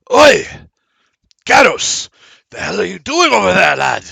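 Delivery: loud, high yell across a chasm. Acted. Mograine shouting at Garrus from across the pit, loud and high. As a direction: bellow his name from across the way. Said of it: "and he yells".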